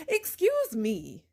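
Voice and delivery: slow, drawn-out "Excuse me" with mock offense; sarcastic excuse me. Answering the in-laws who blame her, slow sarcastic disbelief. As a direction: stretch "Excuse me" out slowly, dripping sarcasm.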